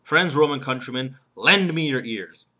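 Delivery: "lend" punched loud and drawn out; stress on lend. Shown as a demonstration. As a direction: declaim it, hitting "lend" hard and slow. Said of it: "actual emphasis goes on the first word".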